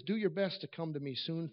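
normal talking voice

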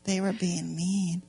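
quiet tsk-tsk telling; hushed and slow. Acted.

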